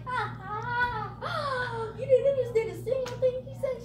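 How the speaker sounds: high, teasing fake laugh; sing-out mock laughter, then teasing; mocking taunt. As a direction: gloat at someone who understood nothing. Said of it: "And I was all like".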